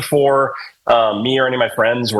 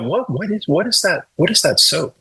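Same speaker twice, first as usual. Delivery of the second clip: retold curious asking; 5 semitones higher than usual. Guest recalls asking about the soap, retold curious asking. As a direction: act out being intrigued, pitched up.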